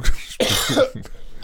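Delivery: sharp choking cough; choking on food. Real, not acted. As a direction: cough on a swallowed bite. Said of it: "jetzt habe ich mich an meinem Reese's verschluckt".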